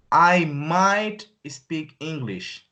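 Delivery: very slow, careful teacher read; slow teaching voice; slow model sentence. Shown as a demonstration. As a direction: spell it out slowly for students. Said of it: "first model take".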